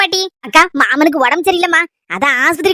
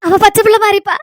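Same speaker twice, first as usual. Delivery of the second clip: higher-pitched, weepy grandmother's plea; tearful pleading; 4 semitones higher than usual. Acted. Grandmother entrusting her granddaughter to the young husband, tearfully pleading. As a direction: plead through tears, pausing between phrases. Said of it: "அழாதுங்க பாட்டி".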